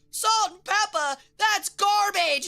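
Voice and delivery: high, loud, slowed scorn; mock outburst. Imagined Steve tossing the letter, mock yell. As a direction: shout the name then trash it. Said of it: "and he's like".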